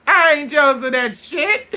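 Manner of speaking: high, loud denial, close to laughing; indignant mock outburst. Retelling a friend denying jealousy, loudly indignant. Acted denial in retold quarrel.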